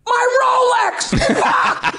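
drawn-out, high-pitched howl; slow, high wail of horrified loss; hammed-up cry of dismay; anguished wail. Joke punchline, distraught lawyer.

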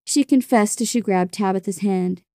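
usual speaking voice

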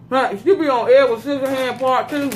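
chewing while talking; speaking between bites; mouth full. Real, not acted. Seen 1: Food put in mouth at -5; jaw working, lips partly closed while speaking.

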